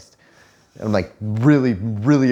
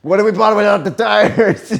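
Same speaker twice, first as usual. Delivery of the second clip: higher, louder, mocking replay of the question; imitating dad mockingly; 9 semitones higher, 5 dB louder than usual. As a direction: parrot the kid's line back with glee. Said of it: "my dad's like".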